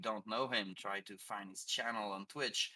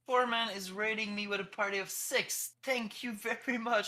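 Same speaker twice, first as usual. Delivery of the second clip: high, loud burst of excitement; excited thanks; 13 semitones higher, 6 dB louder than usual. Real, not acted. Streamer welcoming a raid party, excited and loud. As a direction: greet the raiders with a bright, loud, high voice.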